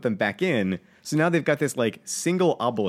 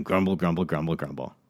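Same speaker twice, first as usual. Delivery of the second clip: softer, mutter the word grumble under your breath; mock grumbling; 6 semitones lower, 6 dB quieter than usual. Shown as a demonstration. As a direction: grumble the words like a sulky kid. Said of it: "I come out of the elevator grumbling".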